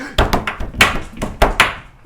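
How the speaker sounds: sharp, loud intake of breath; big acted gasp; mock alarmed gasp. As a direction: gasp as if spotting danger. Soldier spots the tripwire.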